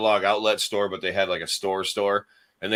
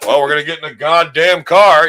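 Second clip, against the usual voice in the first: higher, louder mock old-man grousing; cursing old man; 5 semitones higher, 9 dB louder than usual. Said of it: "You know how old men".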